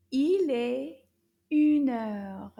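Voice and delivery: Il est une heure loud in a puppet's voice; the puppet repeats loudly. Shown as a demonstration. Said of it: "Can you repeat that?".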